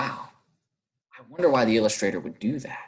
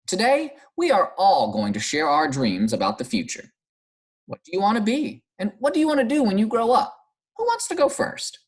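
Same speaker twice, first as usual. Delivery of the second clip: high, bright classroom teacher voice; cheerful teacher; 10 semitones higher than usual. Acted. Miss Bell addressing the class, high and cheerful. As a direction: call the class together brightly.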